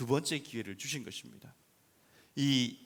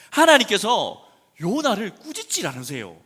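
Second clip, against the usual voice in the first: loud fervent preaching; preaching at full force; 8 semitones higher, 14 dB louder than usual. Acted. Pastor telling the church about God, fervent loud preaching. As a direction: press it on the listeners.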